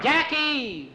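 high-pitched call to a teammate; yelling. A narrator's reading. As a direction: holler the name across the court. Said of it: "Skeeter yelled".

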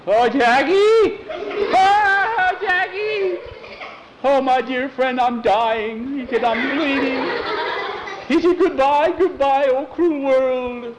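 very high, loud hammed-up death wail, slow; melodramatic dying. Skeeter faking death to Jackie, hammed-up wail. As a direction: overplay a tragic stage death.

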